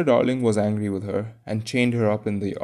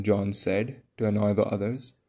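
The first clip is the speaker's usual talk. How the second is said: usual speaking voice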